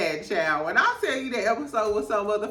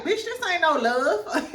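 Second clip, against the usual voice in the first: mock Mary dismissing; 7 semitones higher than usual. Mary rejecting hug.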